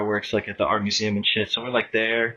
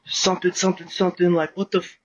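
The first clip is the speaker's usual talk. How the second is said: higher-pitched imitation of someone protesting; mock outraged protest; 6 semitones higher than usual. Retelling the clipped rider's reaction to friends, mock outrage. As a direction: act out an indignant, half-heard complaint.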